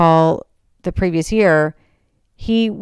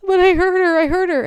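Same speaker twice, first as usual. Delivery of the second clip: imitated crying wail, much higher; tearful protest; 13 semitones higher than usual. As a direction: plead in a high, weepy voice. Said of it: "and I'm crying".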